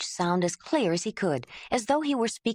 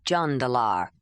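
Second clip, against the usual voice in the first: "Jandalar" said a bit more slowly and lower; lower, slower repeat of his name; 7 semitones lower than usual. Acted. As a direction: take your time on "Jandalar". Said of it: "then slower".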